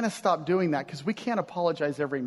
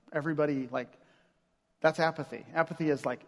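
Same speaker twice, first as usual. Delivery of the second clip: ordinary talk, nothing marked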